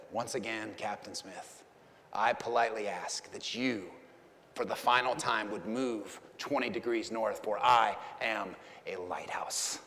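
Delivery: low, soft and unhurried reply; calm certainty, almost murmured. Retold or imitated. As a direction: lighthouse keeper calmly answering the battleship captain. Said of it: "very calmly said".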